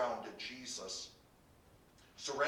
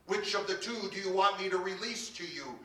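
raised, higher voice of a governor; calling to a crowd; 8 semitones higher, 5 dB louder than usual. A narrator's reading. Governor asks the crowd whom to release, calling out. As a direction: put the question loudly to the crowd.

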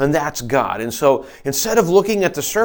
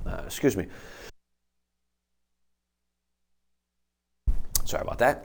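recovering from choking; 5 semitones lower, 8 dB quieter, faster than usual. Real, not acted. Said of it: "Choking on something".

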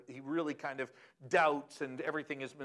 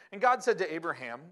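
scripture reading; 5 dB louder than usual. Acted. Reads scripture aloud.

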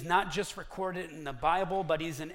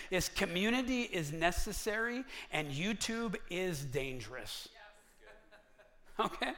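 ordinary delivery

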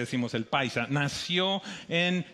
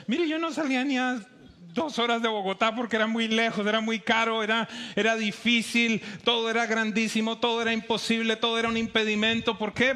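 higher, louder preaching build; fervent preaching push; rising emphasis; 5 semitones higher, 3 dB louder than usual. Real, not acted. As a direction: press each todo harder.

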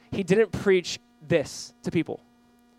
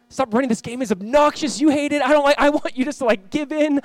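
high, insistent appeal; impassioned preacher voicing God's plea; exasperated urging; 6 semitones higher than usual. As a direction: urge them forcefully to give in. Voices God urging surrender.

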